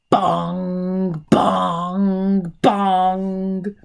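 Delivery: bell bongs. Acted. Teacher reading the bell scene, loud ringing bongs. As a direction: ring it out like a big church bell.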